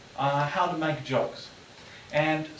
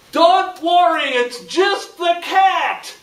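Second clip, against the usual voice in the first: much higher, loud, slow shout; stupid shouted reply; 13 semitones higher, 9 dB louder, slower than usual. Retold or imitated. Kiwi foolishly shouts his answer at the guard, loud and slow. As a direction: shout it out proudly, not knowing better. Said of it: "he goes".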